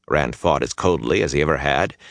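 ordinary delivery, nothing marked

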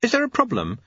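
high, diffident clockmaker; high, unsure little question. A narrator's reading. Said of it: "in the diffident voice he used".

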